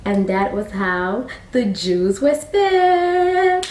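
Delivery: slow, drawn-out sing-song close; storybook ending. A narrator's reading. Storyteller wrapping up the tale, slow sing-song.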